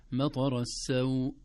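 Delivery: السوء briefly held; short vowel. Shown as a demonstration. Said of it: "هكذا يسمى مد اللين".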